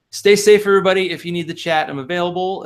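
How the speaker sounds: cheery sign-off. Real, not acted. Sign-off to listeners.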